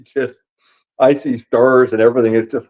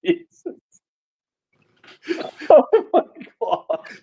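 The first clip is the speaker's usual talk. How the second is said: laughing; 3 dB quieter than usual. Real, not acted. Still laughing at the trick.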